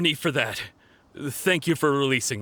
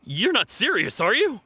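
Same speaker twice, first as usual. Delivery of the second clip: alarmed disbelief; 8 semitones higher than usual. Acted. Told arms will be broken.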